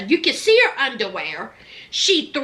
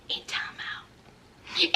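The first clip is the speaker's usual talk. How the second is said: hushed timeout; 13 dB quieter, much slower than usual. Acted. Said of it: "scolding children aside".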